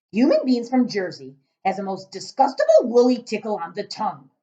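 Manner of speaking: loud booming giant. Acted. Giant correcting Sophie, booming voice. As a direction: boom like a cross giant.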